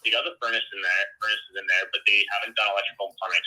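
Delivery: ordinary delivery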